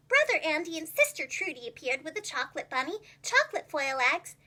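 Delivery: ordinary delivery, nothing marked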